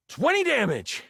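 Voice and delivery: high, excited damage call; dramatic game-master damage call; punched announcement. Acted. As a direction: announce the hit with a high jump in pitch.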